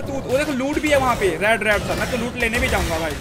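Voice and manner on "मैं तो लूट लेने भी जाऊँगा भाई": usual speaking voice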